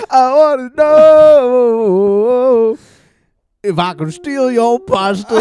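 high, slow, drawn-out character voice; goofy high slow plea; Professor Plumpkins voice. Acted. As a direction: stretch it out like a silly professor. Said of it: "heard from Professor Plumpkins".

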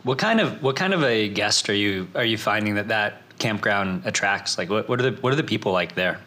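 ordinary talk, nothing marked

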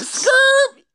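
very high, loud tire squeal; mock skid screech. Host recounts a player sliding after a hit, squealed skid noise. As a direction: screech it like skidding tires.

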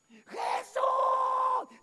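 very high, drawn-out loud call; insistent shouting. Retold or imitated. Mother following Jesus as he walks on, insistent shouting. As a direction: call out loudly, refusing to give up. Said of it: "sigue insistiendo".

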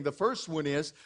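ordinary talk

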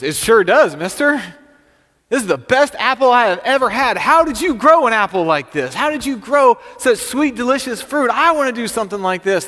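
put-on high, loud childlike enthusiasm; excited little boy. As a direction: gush like an amazed kid. Little boy thrilled by the apple.

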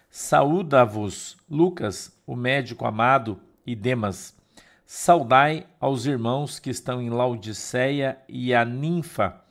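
firm, projected Bible reading; measured, declamatory reading with weighty pauses; scripture reading. Acted. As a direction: read the epistle out to the congregation, phrase by phrase. Reads the Bible passage aloud.

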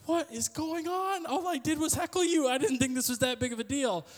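very high-pitched, protesting dismay; bewildered mock panic. Heckler baffled by the crying colleague, mock panic. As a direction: plead innocence in a high, hammed-up voice.

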